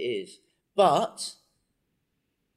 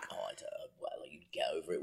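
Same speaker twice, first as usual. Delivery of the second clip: whisper; 7 semitones lower, 13 dB quieter than usual. Real, not acted. Drops to a whisper.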